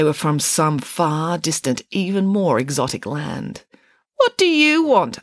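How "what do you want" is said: high, loud suspicious challenge; drawn-out, distrustful questioning. A narrator's reading. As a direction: demand it slowly and warily. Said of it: "asked Judith Piggins suspiciously".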